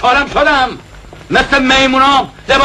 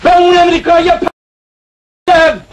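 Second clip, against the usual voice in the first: angry shout; 4 semitones higher, much slower than usual. Acted. Shouting in anger.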